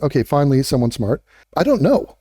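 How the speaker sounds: normal talking voice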